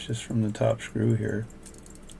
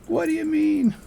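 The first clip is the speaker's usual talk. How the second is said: much higher squeaky mock wife voice; imitating wife; 4 dB louder than usual. Wife calling from downstairs, mock high voice. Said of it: "my wife's like".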